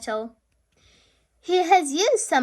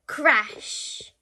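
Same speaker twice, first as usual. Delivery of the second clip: usual speaking voice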